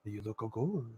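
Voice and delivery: very soft, low admiring murmur; imagined hubby voice. Acted. Hubby telling his wife she looks good, soft low put-on voice. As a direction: trail the put-on husband voice down low and quiet. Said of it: "that's how he sounds".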